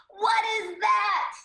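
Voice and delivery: high, slow shout after a laugh; loud mocking laugh. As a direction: burst out laughing, then holler it drawn out. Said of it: "he's laughing and probably saying it in a loud".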